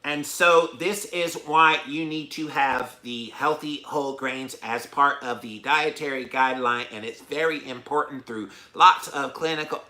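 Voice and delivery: plodding read-from-the-page testimony; mock reading aloud. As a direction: imitate someone reading a prepared statement. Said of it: "Most of these people were reading".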